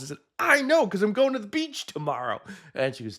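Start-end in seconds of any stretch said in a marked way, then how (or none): 0.4-2.4 mock exasperated reply. Own retort, much higher voice.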